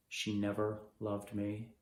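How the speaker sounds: slow, low and soft; hushed, low admission of hurt; quiet resignation. Acted. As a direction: let it sink, slowly and quietly. Same line, now resigned.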